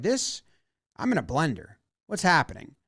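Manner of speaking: imitated bewilderment. Catcher lost amid mixed signals, quiet mock bewilderment. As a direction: drop the volume, as if baffled. Confused catcher, voiced as an aside.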